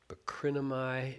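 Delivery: ordinary delivery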